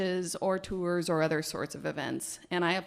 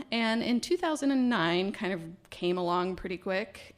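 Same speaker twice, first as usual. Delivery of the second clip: normal talking voice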